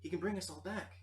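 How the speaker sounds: high, loud eager urging; excited pleading. Acted. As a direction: sell it like good news. NPC excitedly pitches resurrection.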